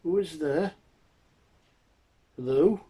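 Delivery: put-on high, loud old man calling out; high questioning blind man. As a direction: call out high to an unseen visitor. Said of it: "The blind man then says".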